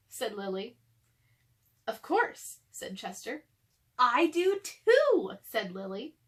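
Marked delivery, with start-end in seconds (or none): 1.9-2.6 higher, proud little voice; Chester's voice. Acted. Chester answers in character. 4.0-5.4 higher, louder and very slow; delighted. Acted. Lily delighted, matching answer.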